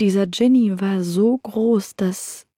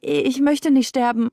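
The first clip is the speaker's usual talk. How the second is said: high, stammering, begging for life; a man about to be killed; terrified pleading; 6 semitones higher than usual. Acted. Merchant begs for his life.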